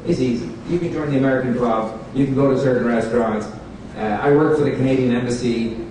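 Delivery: usual speaking voice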